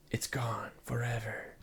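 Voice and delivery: slow, soft whisper; hushed whisper. Pretend. Vlogger mourning an old room from June two thousand and six, whispered. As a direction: whisper it slowly, as over something lost. Captioning a lost-looking eerie room.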